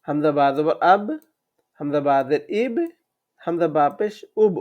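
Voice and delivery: usual speaking voice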